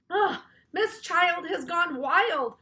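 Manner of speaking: slow, high, loud groan of disbelief; a kid groaning at the teacher; gone wild. A narrator's reading. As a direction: drag out the ugh in dismay. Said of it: "said Ryan".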